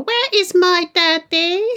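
put-on high, slow creepy child voice; adult faking a little girl; adult doing child voice. Creepy dubbed child asking for her daddy, high and slow. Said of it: "creepy as fuck".

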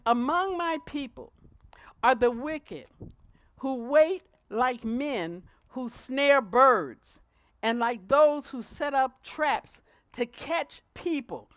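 loud, slow, weighty reading of the verse; preached scripture reading. Acted. Preacher reading Jeremiah to the congregation, loud and slow.

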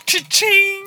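high, sung-out cash register cha-ching. Pretend. Host mimicking the cash register as a high, sung cha-ching. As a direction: ring it out like a till.

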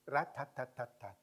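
short even rat-tat-tat beats; syllable-timed rhythm. Shown as a demonstration. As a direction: give each tat the same weight. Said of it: "English of the Caribbean".

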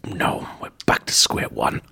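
low defeated whisper. Acted. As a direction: sigh it out under your breath. Deflated detective aside.

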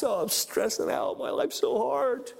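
mock overwhelmed wail at normal volume; stressed-out whining. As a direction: moan about it, stretching the end. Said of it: "men who were in distress".

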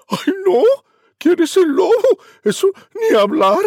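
voz de cerdito asustado, mucho más aguda y fuerte; frightened piglet. Acted. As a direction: chilla como un cerdito aterrado. Cerdito asustado responde al lobo. (cerdito asustado contesta al lobo con chillido agudo)

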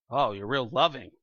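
dry, mocking sarcasm, pitched low; mock-sarcastic thanks to a friend. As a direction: say it like you don't mean it. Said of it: "I might say it like that, all sarcastic".

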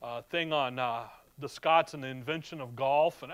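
ordinary talk

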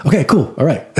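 high, laughing okay cool; laughing inner voice. Retold or imitated. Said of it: "and then I'm like".

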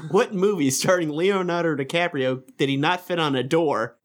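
ordinary talk

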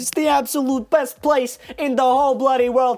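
high-pitched hammed-up New York accent; different accent. New Yorker plugs Pizzario's in a mock accent. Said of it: "with a different accent".

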